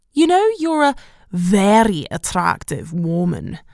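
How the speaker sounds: rolled R's in a bad Scottish accent; hammed-up Scottish accent, R's rolled; appalling Scottish accent. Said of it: "rolling my R's in an appalling Scottish accent".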